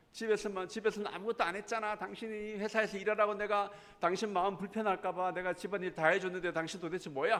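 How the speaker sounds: high-pitched accusing tirade, acted out; quarrelling wife. Wife throwing housework back at her husband, acted angry. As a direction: mimic a wife letting loose in a marital fight. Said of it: "부부싸움을 하기 시작했어요".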